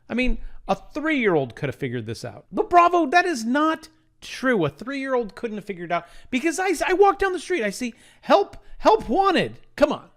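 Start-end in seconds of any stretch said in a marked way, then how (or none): 2.5-4.7 high, loud fake host protest; mock objection. Fake host protesting to Bravo, high and loud. 6.6-10.1 high, loud exasperated rant. Pretend. Fake host arguing about jobs, high and exasperated.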